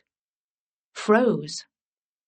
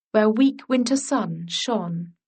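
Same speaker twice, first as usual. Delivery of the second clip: plain reading voice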